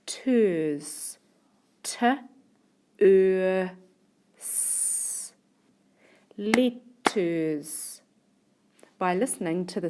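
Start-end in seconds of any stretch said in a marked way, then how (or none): 0.1-5.3 "Ters", then "t", "er", "s" each alone; sounding out. Shown as a demonstration. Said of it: "sounds we can hear in the second syllable". 6.4-8.0 "letters" split in two with a gap; deliberate syllable-by-syllable "letters"; broken into syllables. Shown as a demonstration. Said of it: "sounds that we can hear in each syllable".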